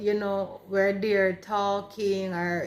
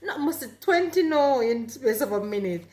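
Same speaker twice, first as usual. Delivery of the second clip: much higher, louder, laughing protest; laughing denial; 5 semitones higher, 4 dB louder than usual. Retold or imitated. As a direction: deny it through a laugh. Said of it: "And I said".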